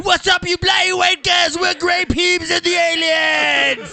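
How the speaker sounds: loud, high British stage yell; British punk singer. Acted. Co-host greeting the punk band's crowd, hammed-up British shout. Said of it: "British punk band, yeah".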